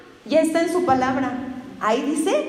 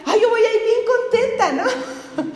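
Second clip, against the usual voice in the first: put-on laughing naive young self; 5 semitones higher than usual. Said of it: "Y yo decía".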